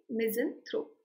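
misanthrope as MIZ-uhn-thrope; schwa in middle. Shown as a demonstration. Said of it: "ये आ गया अपना schwa". (misanthrope के बीच में हल्का 'अ')

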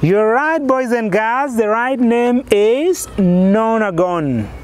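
ordinary talk

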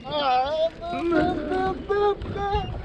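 rising, wailing no no no; dismayed wail. Real, not acted. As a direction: wail as the fish slips away. Fish escapes at the hook.

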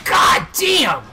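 loud high exclamation; shout. Real, not acted. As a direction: yell it out in shock. Shocked shout at game.

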